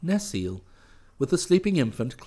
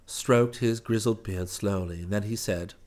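usual speaking voice

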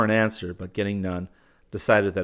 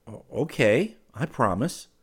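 higher, hesitant agreement; greatly puzzled; 5 semitones higher, 4 dB louder than usual. A narrator's reading. He promises her silence, puzzled. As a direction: stammer slightly, mildly puzzled. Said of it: "he said, greatly puzzled".

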